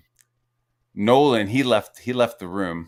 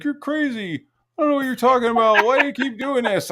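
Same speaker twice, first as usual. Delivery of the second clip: high-pitched, hurried mock denial; mock protesting opponent; 14 semitones higher, 5 dB louder than usual. Leaker denying it to the man confronting him, mock protest. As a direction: imitate a worked-up accuser, pitched way up.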